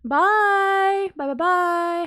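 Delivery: high sung-out byes; singsong bye. Real, not acted. Host waving off viewers in a high singsong. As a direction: sing the goodbye.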